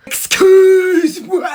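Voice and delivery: high, long-drawn cry; slow high holler; stretched-out yell. Acted. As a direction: draw the word right out, high. Stretched high yell in night scene.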